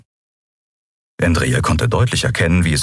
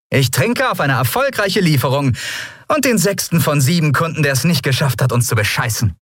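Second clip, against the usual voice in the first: higher-pitched, wry celebratory captain; captain making a toast; 10 semitones higher than usual. A narrator's reading. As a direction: raise your voice into a wry toast.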